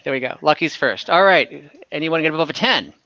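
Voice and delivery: normal talking voice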